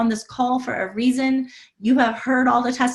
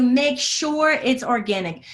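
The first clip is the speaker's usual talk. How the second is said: ordinary talk, nothing marked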